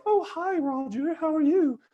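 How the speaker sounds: very high-pitched client voice; mimicked client. Client answering Roger's phone call, in a high put-on voice. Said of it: "And they're like".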